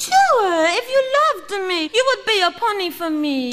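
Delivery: pouting French guilt-trip; French accent. Acted. Claudette guilt-trips Stuart into wearing underwear, pouting French accent. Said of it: "in a French accent".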